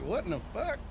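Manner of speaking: breathy hushed shock; stunned half-whisper; whispered disbelief. Retold or imitated. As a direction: whisper it to your buddy in shock. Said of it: "I look at him like".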